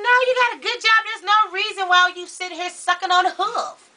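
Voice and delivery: high-pitched retold rant; exasperated protest. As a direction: plead indignantly, voice pushed way up. Retelling her protest to him.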